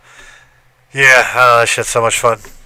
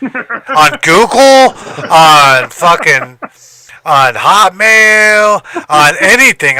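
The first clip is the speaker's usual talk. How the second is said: each site drawn out very slowly and loud; slow, loud, stretched-out disbelief; emphatic list; 5 semitones higher, 6 dB louder, much slower than usual. Retold or imitated. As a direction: hammer every item slowly. Listing sites in disbelief.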